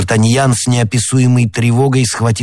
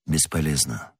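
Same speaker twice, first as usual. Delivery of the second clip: low, very soft voice; hopeless resignation; 5 semitones lower, 10 dB quieter than usual. A narrator's reading. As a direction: say it quietly, all hope gone. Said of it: "сказал Атос". (тихо, низко, обречённо)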